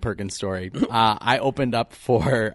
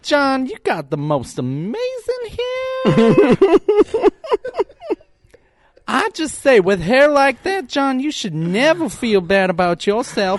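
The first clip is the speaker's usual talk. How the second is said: put-on old southern gentleman; 14 semitones higher, 6 dB louder than usual. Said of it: "I would just do this old Southern accent".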